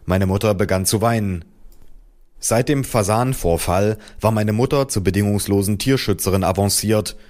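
usual speaking voice

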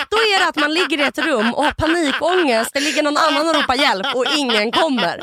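loud outburst through laughter; worked-up laughing. Real, not acted. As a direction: laugh helplessly while describing your nightmare. Worked-up punchline about hell, laughing.

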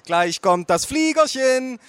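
high, slow singsong coaxing; baby-talk aunt. Acted. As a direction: coax a baby to eat, high and drawn out. Aunt feeding baby like plane.

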